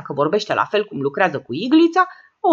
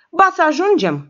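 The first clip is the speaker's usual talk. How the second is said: higher, stubborn contradiction; contrary retort; 7 semitones higher, 4 dB louder than usual. A narrator's reading. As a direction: push back flatly. Petreasca contradicts.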